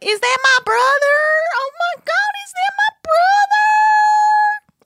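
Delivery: very high, drawn-out hysterical wail; mock panicked Amy. Host mocking Amy's panic over her brother, high wailing. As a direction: shriek it like a frantic sister. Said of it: "so Amy freaks out, like".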